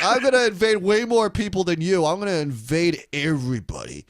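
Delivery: outbid him like a braggart; mock Obama. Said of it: "Obama's gonna be like".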